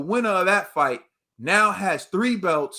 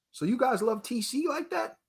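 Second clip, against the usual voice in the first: mocking question; 6 semitones higher, 5 dB quieter than usual. Real, not acted. Mocking fans' loyalty.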